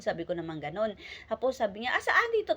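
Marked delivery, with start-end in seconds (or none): 1.9-2.6 mock innocence. Son feigns ignorance to mother.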